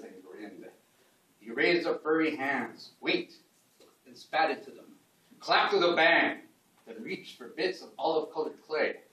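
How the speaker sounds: storytelling poem recital, paused phrase by phrase; measured spoken verse with sudden emphatic bursts; poetry reading. Acted. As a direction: tell it as a mythic tale in verse, punching the big actions.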